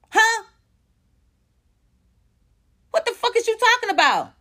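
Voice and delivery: high, incredulous demand; outraged disbelief. Real, not acted. Defending [name]'s family against haters, high and incredulous. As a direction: spit it out, high and exasperated.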